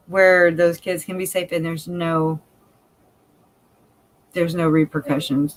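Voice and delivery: ordinary talk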